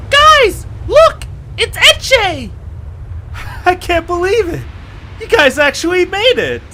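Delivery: joyful cry of reunion; overjoyed shout. Acted. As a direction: call out to long-lost friends in delight. Etche overjoyed seeing friends.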